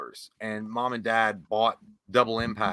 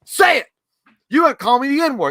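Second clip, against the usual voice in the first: high, loud furious challenge; angry yell; 12 semitones higher, 11 dB louder than usual. Retold or imitated. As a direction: yell it at him like a dare. Samuel L. Jackson yelling in scene.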